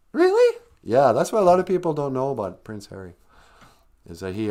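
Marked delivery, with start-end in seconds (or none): 0.1-0.6 very high little squeak; amazed sidekick character. Acted. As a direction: squeak it like an astonished puppet. Sidekick character reacts in surprise.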